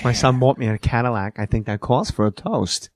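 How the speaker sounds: low, whispery Walken; Christopher Walken. Shown as a demonstration. Comic demonstrating whispered Walken for impressionist. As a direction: let it fall into a near whisper. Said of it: "go down into the whisper".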